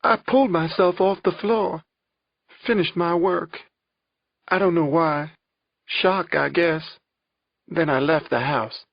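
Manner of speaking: high-pitched, shaken victim's account; halting phrases, still upset; distressed testimony. A narrator's reading.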